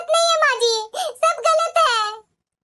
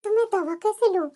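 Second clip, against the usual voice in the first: weak and quiet; 8 semitones lower, 5 dB quieter than usual. Acted. New mother left without breakfast.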